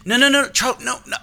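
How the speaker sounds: high, frantic no no no; panicked calling. Retold or imitated. Dad frantically calling Charlie back, high and panicked. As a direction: try to stop the kid before it's too late.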